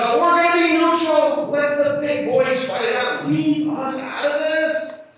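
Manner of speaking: high, breezy mock-naive voice, slowing at the end; mock Dutch government. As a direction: play the carefree neutral government waving the war away. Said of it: "the Dutch government said".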